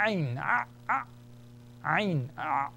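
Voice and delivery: Arabic letter sound demo; glottal ain. Shown as a demonstration. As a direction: squeeze the Ah out of the throat. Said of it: "It's a glottal sound".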